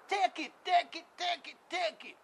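high, sing-song hawking of take it; mock street seller. Mocking buyers grabbing Haiti, as a high-pitched hawker. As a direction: offer it up high like a market hawker.

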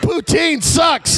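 hammed-up Canadian protest; badly hidden Canadian. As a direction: shout a fake Canadian denial. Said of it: "A Canadian who is not good at hiding".

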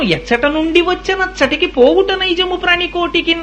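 high, sustained chanted verse; verse recitation. Acted. Preacher quotes verse on where all beings return, high chant. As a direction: intone it on a high held pitch.

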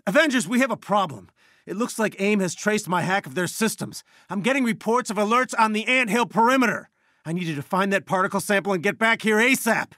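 urgent, high-pitched warning; alarmed. Acted. Warning Avengers their hack was traced, alarmed. Warns team of a breach.

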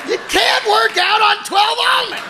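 outraged yell; exasperated shout. Real, not acted. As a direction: shout it in disbelief. Outraged at tiny diet.

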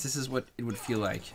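usual speaking voice

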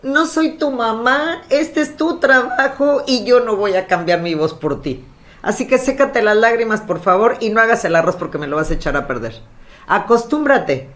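high, slowed, stern talk; firm boss lecture. Retold or imitated. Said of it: "le digo".

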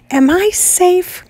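high, slow frightened quaver; high scared quiver. Acted. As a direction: ask it trembling with fear. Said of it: "quivered Piggy".

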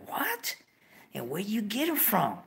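squeaky high disbelieving what; stunned question to the comadre; shocked. Retold or imitated. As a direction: jump way up in pitch on what.

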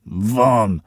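a curt, angry growl; barked-out curse at an intruder. A narrator's reading. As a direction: snarl the word at him. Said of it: "warknął".

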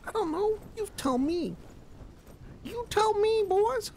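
loud. Acted. Character speaks loudly.

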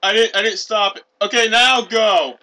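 loud, high protest; heated arguing. Real, not acted. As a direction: argue back loudly over the signal. Quarrels over who said go.

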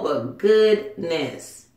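normal talking voice

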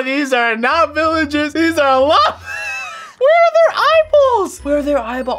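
very high, building to a shout; shocked shrieking. Real, not acted. Finding llamas with no eyeballs, shrieking in shock. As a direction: squeal in horrified disbelief.

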